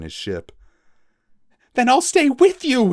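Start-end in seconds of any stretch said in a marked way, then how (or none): 1.7-2.9 much higher, much louder, defiant. Acted. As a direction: refuse to leave, defiantly. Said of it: "he said defiantly".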